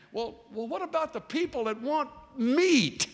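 high, fretful question; worried question. Acted. Moses still fretting to God about meat, a touch worried. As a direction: fret about the meat problem. Voicing Moses' lingering worry.

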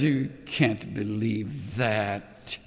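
slow, low, sneering whisper of doubt; the tempter murmuring in your ear; a lightly voiced, taunting enemy. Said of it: "and the enemy says".

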